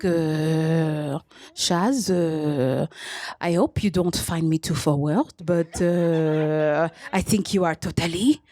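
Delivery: put-on low, slow, lightly French-accented seducer; French lover. Comic voicing the French match's text, low light French accent. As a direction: purr it like a smooth Frenchman, lightly. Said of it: "he's like".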